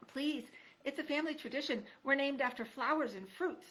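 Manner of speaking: high, pleading mouse voice; small timid mouse begging; mouse girl pleading. Acted. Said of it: "mouse explains politely".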